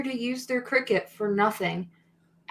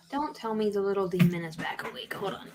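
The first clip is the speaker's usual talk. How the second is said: whispering; 3 semitones lower, 7 dB quieter than usual. Real, not acted. Baby waking, speaker whispers.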